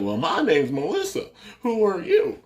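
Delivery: high, sassy retort; sassy comeback rising high at the end; sassing back. Retold or imitated. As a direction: imitate a receptionist giving attitude. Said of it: "Like, sassing her".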